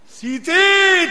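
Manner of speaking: high, loud, drawn-out call. Acted. Rama calls to Sita, long and loud.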